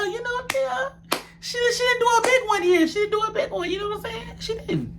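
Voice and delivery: high, soft, sing-song put-on voice; mock high voice fading to near silence. Teasing Dia's fight.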